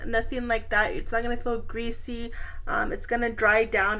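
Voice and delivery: ordinary delivery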